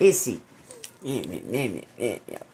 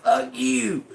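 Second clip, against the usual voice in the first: retold curse; 10 semitones higher than usual. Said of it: "I'm like".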